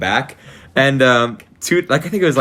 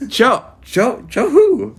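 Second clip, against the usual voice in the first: teacher asking Joe who, higher and puzzled; 10 semitones higher, 3 dB louder, much slower than usual. Retold or imitated. Teacher asks the class about Joe, puzzled and high. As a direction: pitch up, confused teacher. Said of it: "She goes".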